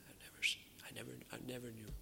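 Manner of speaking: hurried whispered denial; quick breathless whispered stammer; frightened whisper. Retold or imitated. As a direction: whisper it fast, afraid to be overheard. Peter's denial voiced in sermon.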